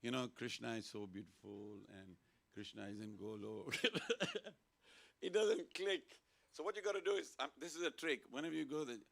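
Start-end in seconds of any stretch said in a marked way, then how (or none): 0.3-3.7 softer, subdued lecture demo; just talking. Shown as a demonstration. Speaker showing talk that doesn't click, subdued talking. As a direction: talk on softly, without rousing anyone. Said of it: "I'll just talk saying".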